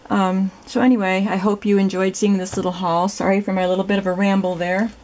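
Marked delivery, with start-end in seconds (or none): none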